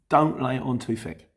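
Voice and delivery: ordinary talk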